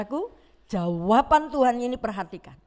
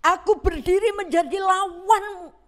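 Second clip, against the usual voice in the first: louder stern angel; 7 semitones higher, 6 dB louder than usual. Acted. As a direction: repeat it louder and higher, warning. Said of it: "malaikat itu berkata".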